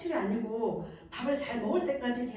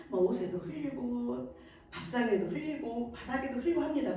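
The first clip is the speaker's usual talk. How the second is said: ordinary talk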